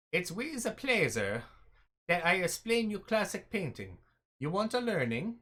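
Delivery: half-hearted Italian painter; mock Italian accent. Gamer voicing the painting teacher NPC in a light mock Italian accent. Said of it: "I don't even know what a-, accent this guy's going for".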